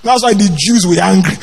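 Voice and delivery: normal talking voice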